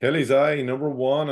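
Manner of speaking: calling each number out, then repeating it; sing-song bingo number calls; bingo caller. Acted.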